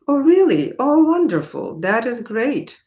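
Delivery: high, loud delighted exclamation; light up with pleasure at the news. Real, not acted. Teacher delighted by a viewer's message.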